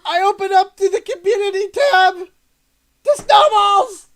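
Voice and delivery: high, loud thrilled exclaiming; delighted cry over finding the snowballs; excited. Real, not acted. Thrilled at community tab.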